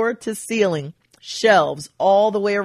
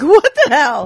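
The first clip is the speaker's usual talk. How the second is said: shocked; 7 semitones higher, 5 dB louder than usual. Retold or imitated. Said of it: "And I'm like".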